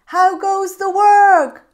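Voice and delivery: high, loud, slow bossy call; lazy farmer calling out to the duck, high and loud. Acted. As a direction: call down lazily from bed.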